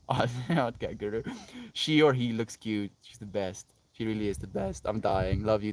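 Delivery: usual speaking voice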